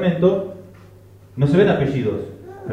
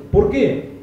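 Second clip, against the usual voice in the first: higher-pitched, incredulous retold question; mock protest; 6 semitones higher, 4 dB louder than usual. Relatives object to the name Juan, mock incredulous protest. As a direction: imitate relatives challenging a name. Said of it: "le dijeron".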